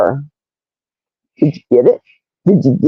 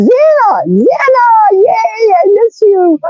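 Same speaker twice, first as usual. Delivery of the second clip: excited greeting; 12 semitones higher than usual. Real, not acted.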